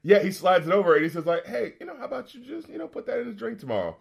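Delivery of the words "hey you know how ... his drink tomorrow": high, hushed imitation of a schemer; acted-out furtive offer, pitched up and soft; sly stranger. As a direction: slip the shady suggestion in softly. Quoting the stranger's furtive proposal.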